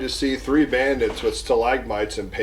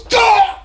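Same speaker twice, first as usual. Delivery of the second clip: high, loud death yelp; sudden dying cry; 9 dB louder than usual. Acted. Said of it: "all of a sudden he goes".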